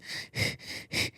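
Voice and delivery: long, breathy, exhausted sigh; heavy sigh between answers; tired unvoiced exhale; weary sigh. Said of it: "my sister's like".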